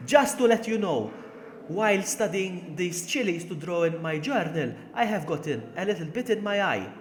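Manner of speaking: high, loud Maltese accent. Shown as a demonstration. Streamer demonstrating for chat, exaggerated Maltese accent. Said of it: "That's how Maltese people sound like".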